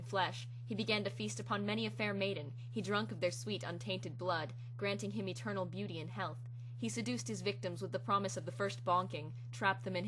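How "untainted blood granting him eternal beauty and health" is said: ordinary delivery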